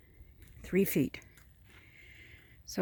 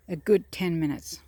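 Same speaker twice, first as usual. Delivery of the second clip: ordinary talk, nothing marked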